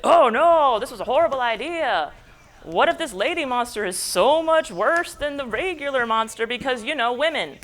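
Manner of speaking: high, loud, panicky outburst; panicked Victor. Acted. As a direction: freak out at your own bad idea. Victor panics about lady monster.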